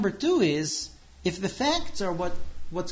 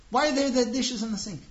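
livid wife; 7 semitones higher than usual. Acted. Wife livid at husband about the kitchen. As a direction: demand an answer, indignant. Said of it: "she's livid".

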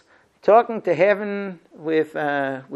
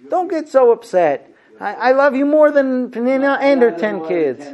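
higher and louder, an overdone husband pleading; exasperated husband; 8 semitones higher, 6 dB louder than usual. Retold or imitated. Husband mocked for always saying this, loud high pleading. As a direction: ham up a husband begging his wife. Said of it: "Isn't that what husbands always do?".

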